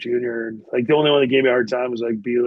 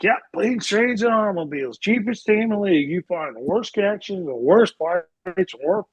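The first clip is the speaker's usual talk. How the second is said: high-pitched rant from the back of the bus; high, rambling drunken complaint; tipsy teammate's rant; 7 semitones higher than usual. Retold or imitated. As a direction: imitate a boozy loudmouth griping on and on. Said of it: "Theo was still feeling pretty good".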